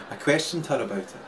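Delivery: normal talking voice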